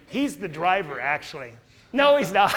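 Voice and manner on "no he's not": louder, higher, breaking into laughter; laughing denial. Real, not acted. Speaker takes back his own joke to the audience, laughing. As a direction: blurt it out and dissolve into laughs.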